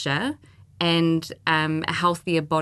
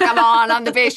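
boastful; 3 semitones higher, 5 dB louder than usual. Retold or imitated. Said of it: "you being like".

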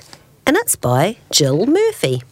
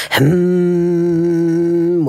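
long, steady imitated appliance hum; humming refrigerator. A narrator's reading. Refrigerator keeping Mister Bear awake, sustained imitated hum. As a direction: drone like a fridge. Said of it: "went the refrigerator".

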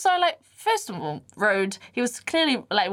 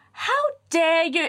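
high, slow mock outrage; playing outraged; 9 semitones higher, slower than usual. Mum playing along to embarrass a man, mock outrage. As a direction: draw it out like a scandalised mum. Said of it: "she played along with it".

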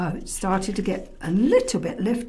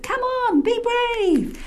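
high calling voice. A narrator's reading. Calling to the lion.

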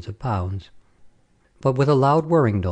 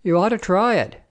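higher-pitched soldier's urging; character voice of a keen soldier; blue-eyed soldier; 10 semitones higher than usual. A narrator's reading. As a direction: urge it on in a raised, lively pitch. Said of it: "said the blue-eyed soldier".

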